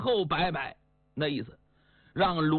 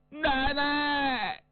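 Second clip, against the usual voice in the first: higher, a tearful call for grandma; tearful cry; 6 semitones higher than usual. Acted. Grandson sees his grandmother, calls out tearfully. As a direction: burst out calling her through held-back tears. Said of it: "泪崩了呀".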